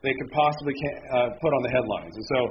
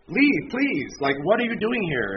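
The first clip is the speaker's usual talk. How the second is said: half-joking shooing away of the sick lady; mock exasperated plea; 7 semitones higher, slower than usual. Pastor voicing waiting customers telling the coughing lady to leave, mock exasperated.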